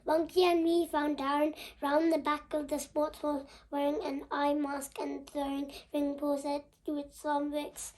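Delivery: plain reading voice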